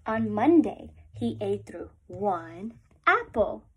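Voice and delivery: normal talking voice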